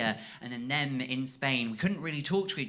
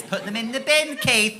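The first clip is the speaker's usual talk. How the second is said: exasperated scolding; 7 semitones higher, 9 dB louder than usual. Acted. Scolding dad Keith.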